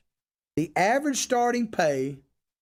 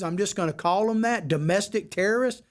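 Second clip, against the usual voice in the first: ordinary talk, nothing marked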